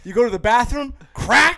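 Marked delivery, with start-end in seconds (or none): 1.1-1.6 high loud mock crack of a beer can; sharp imitated can-opening sound. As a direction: pop the word like a can opening.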